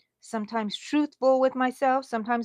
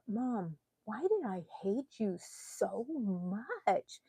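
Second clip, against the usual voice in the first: daughter's voice; 9 dB quieter, much slower than usual. Retold or imitated. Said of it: "She's my".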